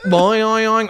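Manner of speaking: high bouncing boing, fading out; boing boing like a spring toy; bouncy springy boingy voice. Retold or imitated. Mocking cartoon sound effect.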